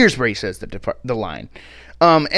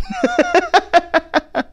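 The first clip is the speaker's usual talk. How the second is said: high laugh. Real, not acted. Cracking up at line.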